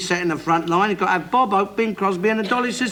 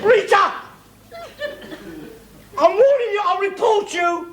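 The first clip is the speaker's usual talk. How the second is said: angry shout; 11 semitones higher than usual. Real, not acted. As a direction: yell a threat at her from the next room. Row over the light switch.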